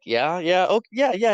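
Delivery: high, loud, gleeful agreement; excited gloating. Retold or imitated. Jackson gloating at his teammate, high and loud. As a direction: crow it back, high and loud with delight.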